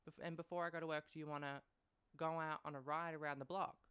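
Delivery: mock-cheery dad offering Max a ride; dad's voice. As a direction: put on the fake-nice father. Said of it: "he's like".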